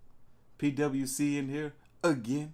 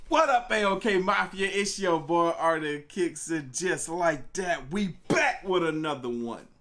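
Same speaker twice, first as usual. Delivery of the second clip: loud, hyped-up show intro; loud channel greeting with the name drawn out; hype channel intro; 4 semitones higher, 4 dB louder, slower than usual. Acted. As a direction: announce yourself like a host.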